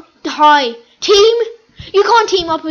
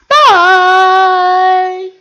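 loud goodbye; 3 semitones higher, 7 dB louder than usual. Real, not acted. Youtuber signing off to viewers with a loud shouted bye. Ending the video.

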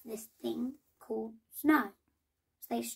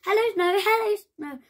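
squealing hello, much higher and louder; 9 semitones higher, 10 dB louder than usual. A narrator's reading. Hare and Fox greeting the first snow, squealing with joy. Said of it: "they squealed".

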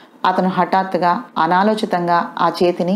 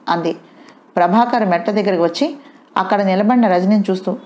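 ordinary talk, nothing marked

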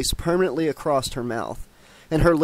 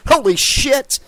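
high, loud startled yelp; 11 semitones higher, 7 dB louder, slower than usual. A narrator's reading. Noon caught naked by Kelly, a startled loud yelp. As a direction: yelp it out, much higher and louder. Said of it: "Noon yelped".

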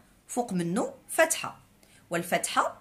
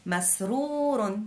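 مسرور stretched syllable by syllable; slow drawn-out word; much slower than usual. Shown as a demonstration. Teacher reading مسرور very slowly to children. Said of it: "كلمة مسرور".

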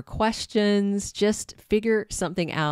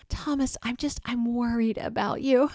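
breathy concern; 6 semitones higher than usual. Retold or imitated. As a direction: fake tender concern, breathy and high. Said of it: "her little breathy thing".

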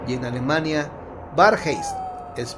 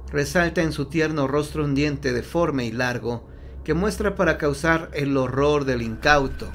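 ordinary talk, nothing marked

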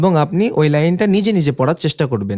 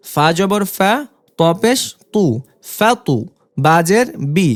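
raised, measured recitation of each syllable; spelling drill; 2 semitones higher, slower than usual. Shown as a demonstration. Teacher to students, spelling Arabic syllables in a clear drill voice. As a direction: call out every letter and vowel sign for learners to repeat.

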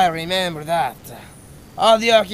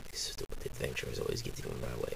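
whispered, fast, under her breath; resentful whispered grumble; grumbled aside; 17 dB quieter, much faster than usual. Acted. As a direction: mutter it so nobody hears.

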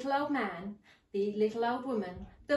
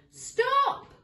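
high, loud shout; old man shouting after the gingerbread man; cry of stop; 7 dB louder than usual. Acted. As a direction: yell after the runaway. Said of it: "cried the little old man".

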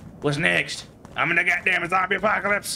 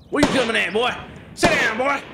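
a cocky Southern soldier calling out his foe; put-on Southern accent; 7 semitones higher than usual. Gamer voicing his soldier in a Southern accent, taunting enemies. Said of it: "So I'm gonna do this accent right here".

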